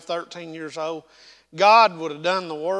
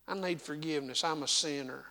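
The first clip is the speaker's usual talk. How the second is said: quiet confession; 10 dB quieter than usual. Real, not acted. Preacher confessing his sin to the congregation, softly. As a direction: drop it to a hush and own it.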